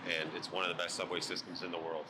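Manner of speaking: usual speaking voice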